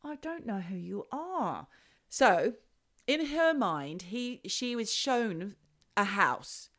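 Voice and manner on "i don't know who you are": side-of-mouth mutter. Retold or imitated. Muttering secretly to the ghost.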